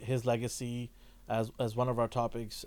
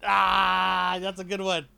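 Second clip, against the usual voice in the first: self-congratulating; 5 semitones higher, slower than usual. Real, not acted. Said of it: "I'm patting myself on the back".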